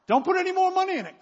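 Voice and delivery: high, loud, impatient clerk voice; annoyed clerk. Retold or imitated. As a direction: brush him off like the fed-up desk clerk. Hakeem voiced in story.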